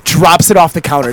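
ordinary talk, nothing marked

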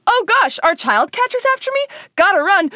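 high, loud mock panic; panicked. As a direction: play it like being chased. Acted chase panic.

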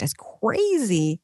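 ordinary talk, nothing marked

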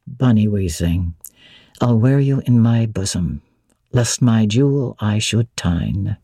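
a hint of a gruff master mocking; lightly voiced Rochester.

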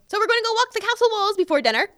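high, quick, lilting professor voice; imitating the professor. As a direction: mimic the old professor's cheery suggestion. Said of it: "my old English professor was like".

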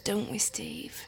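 ordinary talk

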